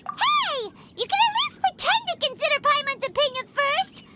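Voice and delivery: high-pitched outraged "Hey!", then a huffy complaint; indignant protest. Acted. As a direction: sound offended at being brushed aside. Paimon protests being ignored.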